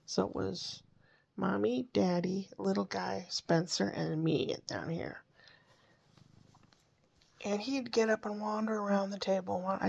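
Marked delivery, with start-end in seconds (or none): none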